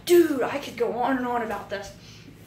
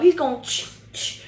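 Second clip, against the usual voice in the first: usual speaking voice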